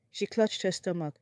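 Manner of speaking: ordinary delivery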